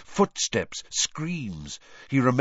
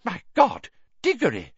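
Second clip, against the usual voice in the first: shocked gasp; 11 semitones higher, 3 dB louder than usual. A narrator's reading. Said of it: "it whispered".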